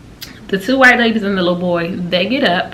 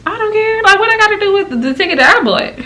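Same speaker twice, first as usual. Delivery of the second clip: exasperated; 12 semitones higher, 3 dB louder than usual. Real, not acted. Exasperated over season ticket claim.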